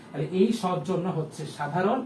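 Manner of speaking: normal talking voice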